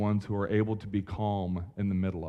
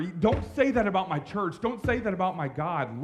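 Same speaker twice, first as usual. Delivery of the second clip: raised, higher-pitched indignant defense of the church; mock righteous anger; 8 semitones higher than usual. Pastor imitating a churchgoer defending church, mock righteous anger. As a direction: act out the churchgoer's indignant comeback, pitched well up. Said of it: "righteous anger".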